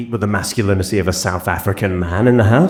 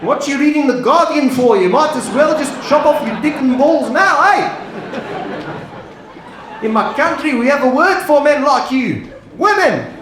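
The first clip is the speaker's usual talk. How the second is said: high, brash South African heckler; South African man heckling. Retold or imitated. The dog's South African owner heckles the Englishman, brash. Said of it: "being like".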